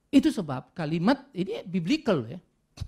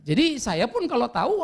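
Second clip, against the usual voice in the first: normal talking voice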